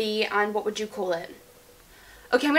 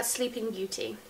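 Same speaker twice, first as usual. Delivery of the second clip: whispering; 10 dB quieter than usual. Real, not acted. Whispered answer.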